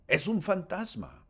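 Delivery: high, loud scared shout; frightened cry. Acted. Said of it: "gritaron llenos de miedo".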